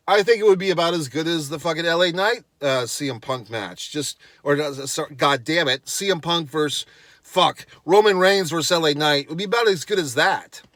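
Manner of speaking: ordinary talk, nothing marked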